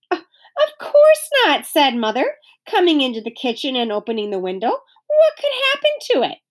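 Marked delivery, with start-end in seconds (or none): 0.1-1.6 lightly voiced mother, high and slow; a hint of a mother. A narrator's reading. Mother reassuring her daughter about the cake, lightly voiced and high. 4.7-6.4 a hint of a mother. A narrator's reading. Mother reassuring her daughter about the cake, lightly voiced and high.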